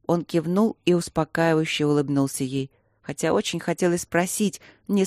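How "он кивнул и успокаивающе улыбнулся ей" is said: ordinary delivery, nothing marked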